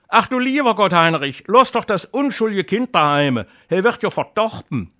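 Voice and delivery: put-on wife begging her husband to leave the child at home, high and anxious; pleading wife. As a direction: beg him to leave the boy home.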